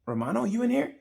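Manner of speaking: high coaxing call into a dark room; gentle call. Retold or imitated. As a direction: friend calling into a dark room for the sulking rider, high and coaxing.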